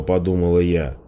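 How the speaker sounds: ordinary delivery, nothing marked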